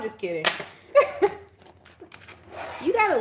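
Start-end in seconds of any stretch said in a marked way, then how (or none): 0.9-1.4 high laugh. Real, not acted. Laughing after joke.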